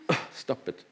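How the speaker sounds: real choked-up aside, very soft; low and hushed, fighting off emotion; emotional. Real, not acted. Preacher telling wife to stop it while getting emotional. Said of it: "I'm getting a bit emotional".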